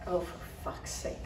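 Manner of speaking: exasperated. A narrator's reading. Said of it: "I said, exasperated".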